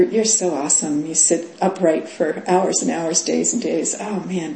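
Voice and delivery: usual speaking voice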